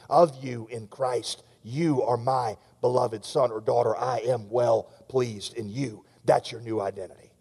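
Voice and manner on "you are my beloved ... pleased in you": soft fatherly reassurance to the guilty; the Father's voice. Retold or imitated. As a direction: speak it gently as the Father would. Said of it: "hearing the voice of the Father speaking".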